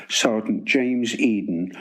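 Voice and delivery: ordinary delivery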